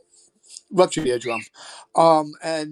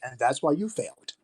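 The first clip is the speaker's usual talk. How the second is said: dry put-down; 3 semitones lower, 5 dB quieter than usual. Retold or imitated. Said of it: "And I'm like".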